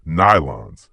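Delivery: usual speaking voice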